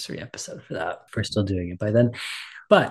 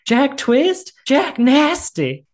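much higher, slow, loud sneering wife; mocking wife; 8 dB louder, much slower than usual. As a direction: drag out Jack Nasty with a knowing sneer. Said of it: "and she goes".

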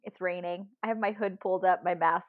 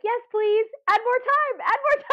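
much higher and louder, hammed-up pleading; mock begging; 6 dB louder than usual. Pleading with the phone countdown, high mock desperation. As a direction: beg for more time in a squeaky panic.

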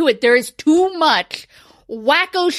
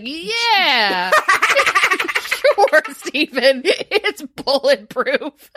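laughing sarcasm; 3 semitones higher, much slower than usual. Retold or imitated. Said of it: "whoever made it is like".

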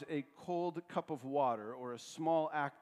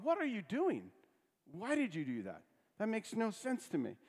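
higher, exasperated pleading; exasperated Jesus; 7 semitones higher than usual. Retold or imitated. Preacher voicing Jesus exasperated with disciples, exasperated. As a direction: throw up your hands in mock disbelief. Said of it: "feel the exasperation in Jesus' language".

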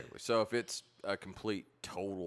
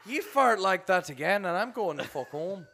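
put-on Irish accent; 13 semitones higher, 5 dB louder than usual.

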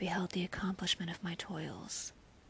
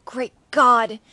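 loud anguished exclamation; horrified outcry; 3 semitones higher, 20 dB louder than usual. A narrator's reading. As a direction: cry it out in horror. Horrified outburst in narration.

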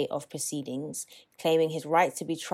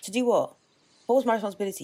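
indignant retort; 4 semitones higher, much faster than usual. Real, not acted. Partner pushing back in a heated argument, indignant and demanding. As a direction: throw the question back, outraged.

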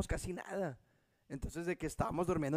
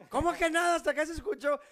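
higher, pressing retold wife; mock angry questioning; 10 semitones higher, 3 dB louder than usual. Wife grilling him from upstairs, higher mock demand. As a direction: demand answers, half-hammed. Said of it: "desde arriba gritándome".

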